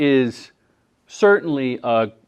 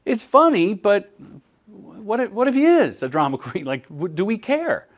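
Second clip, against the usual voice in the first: gentle questioning; 6 semitones higher than usual. Retold or imitated. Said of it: "And I go".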